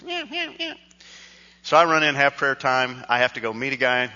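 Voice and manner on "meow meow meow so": meowing cat. Retold or imitated. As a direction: mimic a kitten that keeps on mewing. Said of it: "it's still going".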